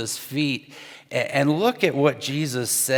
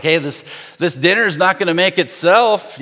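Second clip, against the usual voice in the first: pointed hint, a bit louder; louder hint so the sister hears; passive-aggressive; 5 semitones higher, 6 dB louder than usual. Retold or imitated. Martha hinting loudly at her idle sister, passive-aggressive. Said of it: "passive-aggressive thoughts".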